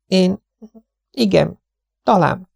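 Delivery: high, gentle lady's voice; tired young wife. Acted. Mrs Tenius refusing food from Miss Lawson, high and polite. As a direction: decline politely, worn out.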